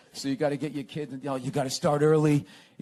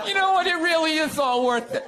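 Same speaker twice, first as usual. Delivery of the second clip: much higher and louder, fake-sentimental; mock sappy parent; 10 semitones higher, 3 dB louder than usual. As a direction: ham up a gushing dad.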